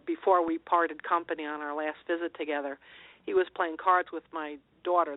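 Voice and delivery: ordinary delivery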